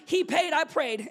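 loud, slow declaration; punchline thrown to the congregation; preached punchline. Real, not acted. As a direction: land it slowly, full voice.

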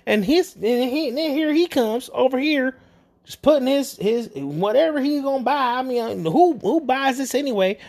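high, whiny lament about a lost cat; mock whiny old lady. Imitating the older lady pouring out her troubles, high and whiny. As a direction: ham up a self-pitying old woman, pitched high.

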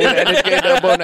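imitated stammering babble; mock a stutter, starting to laugh. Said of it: "five words after he stutters".

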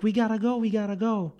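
high-pitched urgent repetition; urgent panic. Retold or imitated. Dad fleeing the haunted house, urgent. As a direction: imitate a dad hurrying everyone out.

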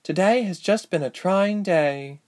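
high, slow weary voice; weary princess. A narrator's reading. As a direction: sigh it out, worn out.

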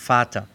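"Vater" with an f sound, "fahta"; German "Vater" starting on f; V como F. Shown as a demonstration. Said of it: "la misma que la F en alemán". ("Vater" pronunciado "fáta")

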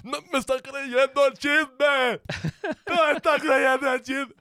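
mock panicked friend, slow and high; imitating a short-circuiting friend; confused friend. Friends confused by her questioning. (amigo confundido, lento y agudo)

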